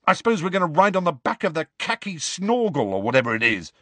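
fast, sarcastic demand. A narrator's reading. Ron to Luna, demanding sarcastically. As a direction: rattle it off mockingly. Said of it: "Ron demanded".

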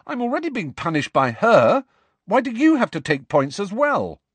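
high, loud outraged cry; teen protesting an unfair punishment; furious protest. A narrator's reading. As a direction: burst out against the unfairness. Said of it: "furious at this injustice".

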